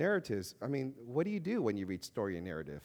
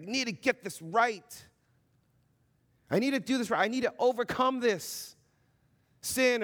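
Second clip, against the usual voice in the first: mock strained resolve; 9 semitones higher, 5 dB louder than usual. Believer straining to overcome sin, tense and higher. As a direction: sound like you're straining to get it right.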